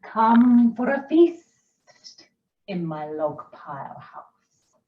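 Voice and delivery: slow, drawn-out snake voice; slithery snake. Acted. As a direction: let each word slide like a snake. Snake invites mouse.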